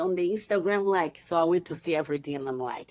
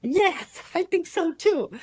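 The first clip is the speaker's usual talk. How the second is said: much higher, thrilled agreement; eager yes; 13 semitones higher, slower than usual. Retold or imitated. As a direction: jump at the chance. Agreeing to compete at Arnold.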